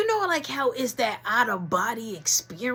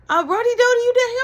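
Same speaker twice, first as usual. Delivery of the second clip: high, loud call down the stairs; dad calling out, searching the house; calling out from afar; 10 dB louder, faster than usual. Retold or imitated. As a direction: call down into the basement.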